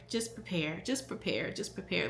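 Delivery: unvoiced, whispered repeat of "prepare"; softly whispering to friends who didn't prepare. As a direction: breathe it like a quiet warning. Retold advice, dropped to whisper.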